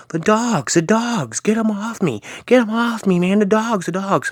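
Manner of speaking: very high, frantic retold cries; hammed-up high-pitched hallucinating panic; mock screaming. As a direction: imitate a man panicking at imaginary dogs. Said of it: "he was, like, screaming".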